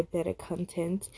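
usual speaking voice

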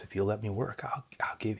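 soft, whispered promise. Acted. Tender whisper of Jesus.

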